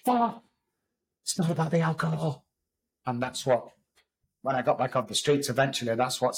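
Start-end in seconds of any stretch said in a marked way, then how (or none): 0.0-2.4 sudden realization. Retold or imitated. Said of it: "and I went".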